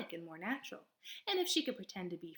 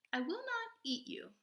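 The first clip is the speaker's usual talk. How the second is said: higher, even robot voice; 10 semitones higher than usual. Acted. Robot answering the possum in a higher, even voice. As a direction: answer plainly, like a machine.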